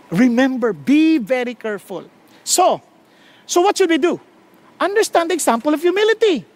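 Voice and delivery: ordinary delivery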